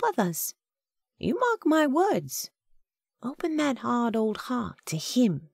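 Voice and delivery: high, motherly exhortation in character; earnest Agatha. A narrator's reading. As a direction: urge him with devout conviction.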